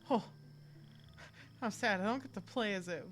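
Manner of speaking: usual speaking voice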